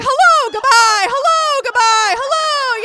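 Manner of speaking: loud, very high singsong shout; hammed-up greetings. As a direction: alternate gleeful hollers. Acts out toys greeting and leaving.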